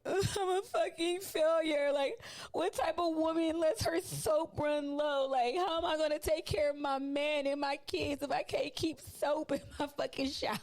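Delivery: high, laughing mock despair over the soap; mock meltdown. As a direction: ham up a self-pitying wail, half laughing. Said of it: "And I'm like".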